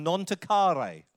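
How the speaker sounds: "non toccare" said slowly and carefully; "non toccare" carefully pronounced; careful Italian. Shown as a demonstration. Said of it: "Is that good?".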